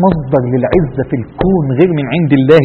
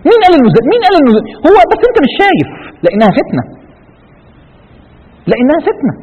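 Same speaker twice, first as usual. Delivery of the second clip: high-pitched, insistent preaching; impassioned challenge; 10 semitones higher than usual. Real, not acted. Preacher rebutting those who admire the corrupt, impassioned challenge. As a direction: challenge them in a high, urgent voice.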